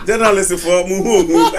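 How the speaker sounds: words mixed with real laughter; amused, breaking up while speaking; laughing. Real, not acted. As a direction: laugh through the line. Both men burst out laughing.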